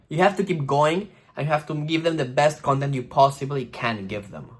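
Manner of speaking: ordinary talk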